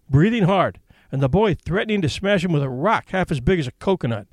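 usual speaking voice

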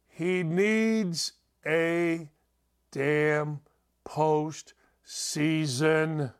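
host demanding a big moment from Trout, slowly; drawn-out emphasis. Real, not acted. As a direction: stretch each word to hammer it home. Host hammering home his point.

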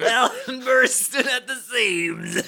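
high-pitched laughing quip. Real, not acted. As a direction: say it while laughing. Laughing punchline.

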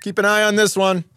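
mock store greeter. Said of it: "the welcome guy is like".

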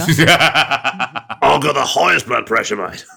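slow, boastful broad Aussie; old-fashioned Australian dad. Pretend. Father boasting about his health, broad Australian. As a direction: brag in a drawn-out mock ocker accent. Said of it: "Dad's got quite a high blood pressure".

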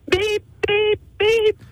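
high beeping alarm voice. Pretend. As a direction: beep like an alarm clock. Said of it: "pretend to be the alarm".

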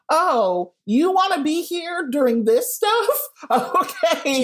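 high, mock-incredulous question; retold surprise, voice jumping high; surprised reaction. Retelling own reaction to friend's visit.

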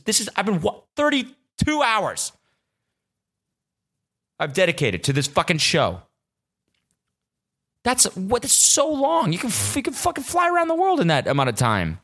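high-pitched incredulous outrage; exasperated anger. Real, not acted. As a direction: vent in a high, indignant voice. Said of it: "I was so mad".

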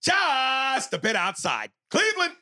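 high, loud drawn-out call; announcer impression. Announcer calling a pitch for Cleveland, high and drawn out. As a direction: stretch the call out like a commentator. Performs a sports announcer catchphrase.

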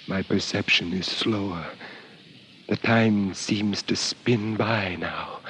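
slow breathy whisper. Acted. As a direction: drift off in a whisper. Deep in trance.

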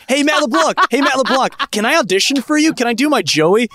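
thirty-something fan mock-pleading with a celebrity; mock eager fan. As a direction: beg for the audition like an overeager adult. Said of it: "If I'm, like, in my thirties".

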